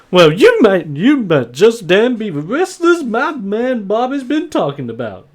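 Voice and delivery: high, lively farm wife voice; Maud. Acted. Farm wife meeting her husband's wrestler guests, high and lively. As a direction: size up the visitors in a high, swooping voice. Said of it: "As she looks over to you guys and just goes".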